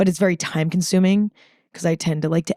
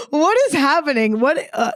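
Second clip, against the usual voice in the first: high, baffled squeak at her own welling emotion; startled at own tears; 8 semitones higher than usual. Retold or imitated. Telling listeners how she reacted to tearing up, high and startled. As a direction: pitch up, caught off guard by tears. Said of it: "I'm like".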